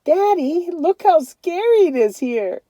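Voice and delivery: put-on high, small boy voice, a touch scared; little boy's voice. A narrator's reading. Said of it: "Joey said".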